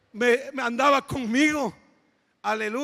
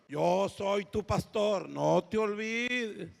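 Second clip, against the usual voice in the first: joven pastor firme; 4 semitones lower, 4 dB quieter, much slower than usual. Retold or imitated.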